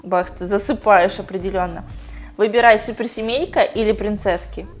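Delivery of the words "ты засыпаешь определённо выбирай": usual speaking voice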